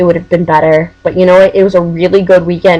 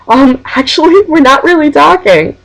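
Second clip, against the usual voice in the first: imitating a friend; 8 semitones higher than usual. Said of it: "David turns to me and goes".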